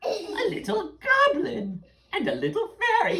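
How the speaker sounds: high, fast, loud gleeful witch. Acted. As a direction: cackle it out like a hag. Witch sees the children.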